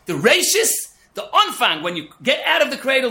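preacher's emphasis; emphatic. Real, not acted. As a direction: drive the point home. Emphatic sermon peak.

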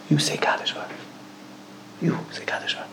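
whispered request to God; whispered plea. Retold or imitated. Father asking God to say Kaddish, whispered plea. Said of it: "He says".

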